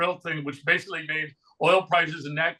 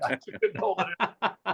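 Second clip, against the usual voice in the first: laughing; 2 semitones higher, 3 dB quieter, slower than usual. Real, not acted. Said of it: "I tried to say it seriously".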